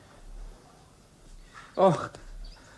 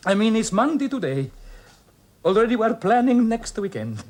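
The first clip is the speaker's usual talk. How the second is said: jovial; 9 semitones higher, 10 dB louder than usual. Acted. Joking to smooth things over.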